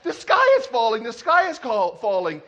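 high, loud cry of alarm; frantic storybook hen; mock panic. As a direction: spread the alarm, hammed-up and urgent. Henny Penny raising the alarm.

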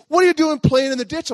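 high, loud scolding question; mad grandma. Retold or imitated. Grandma confronts the boy over the ditch, mad scolding. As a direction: demand an answer as a cross grandma. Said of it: "she's mad".

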